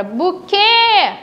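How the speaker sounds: "buquê" with a long final QUÊ; called-out "buquê", closed ê held out; calling the word. Shown as a demonstration. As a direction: stretch the last syllable of "buquê" as if calling it. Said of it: "Quando eu chamo pela palavra".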